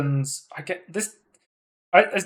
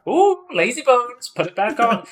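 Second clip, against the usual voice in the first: teasing taunt; 8 semitones higher than usual. Retold or imitated. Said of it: "he's like".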